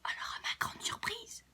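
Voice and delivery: breathy surprised whisper; hushed storyteller wonder; whispered aside. A narrator's reading. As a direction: drop to a whisper for suspense.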